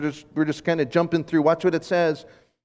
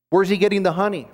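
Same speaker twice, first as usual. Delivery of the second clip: ordinary talk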